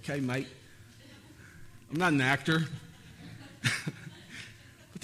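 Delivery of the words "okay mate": mock Australian accent. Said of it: "It was Australian".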